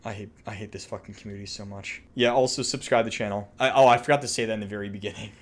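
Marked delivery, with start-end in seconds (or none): 0.0-2.0 very soft, low, defeated; dejected grumble. Real, not acted. Streamer fed up with the community, quiet dejected grumble. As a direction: say it under your breath, beaten.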